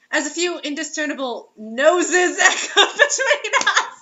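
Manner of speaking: reading through giggles; can barely get the words out for laughing; laughing while reading. Real, not acted. Host cracking up reading the erotic story aloud, giggling.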